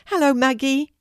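put-on high, bright greeting from a little girl; brightly. A narrator's reading. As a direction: greet her cheerfully, lifted and eager. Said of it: "says Kelty brightly".

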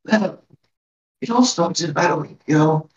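put-on frail old village elder, worried; a hint of a tiny old lady pleading; very old woman. GM voicing elder Grilda.

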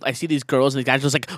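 ordinary talk, nothing marked

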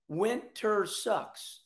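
winter sucks stretched way slow; drawn out, very slow. Shown as a demonstration. Host griping about winter, very slow drawn out. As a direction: drag each word out. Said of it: "repeated for emphasis".

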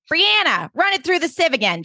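high, panicky chef voice; mock-frantic kitchen order; frantic Suki. Suki barking at her cook, high and panicky.

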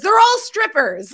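high, loud shocked exclamation; excited blurt. Retold or imitated. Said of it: "Pepper says".

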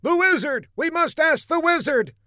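high-pitched townsperson calling out; high, urgent mock shout; acted shout. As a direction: cry out from the crowd in a high voice. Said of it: "someone shouted".

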